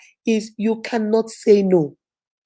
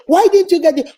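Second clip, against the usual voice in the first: mock annoyed; 6 semitones higher, 4 dB louder than usual. Mocks annoyed person.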